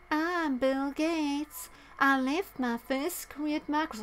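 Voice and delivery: slow, high-pitched whiny mimicry; a poor whiny impression, voice pushed up high; Bill Gates. Vlogger mocking the foreword's writer, whiny impression. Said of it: "it's just like this whiny tone".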